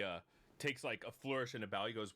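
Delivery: ordinary talk, nothing marked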